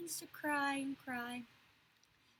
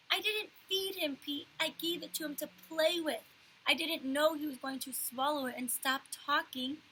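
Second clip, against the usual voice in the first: put-on higher, louder whiny child; upset child; 4 semitones higher, 5 dB louder than usual. A narrator's reading. Fudge defending himself to Pete, loud tearful. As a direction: plead through tears.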